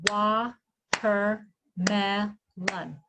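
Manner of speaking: very slow, one syllable per clap; Wa-ter-mel-on split into four slow beats; clapped syllables. Shown as a demonstration. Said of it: "Let's clap out the syllables".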